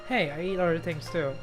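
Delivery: ordinary talk, nothing marked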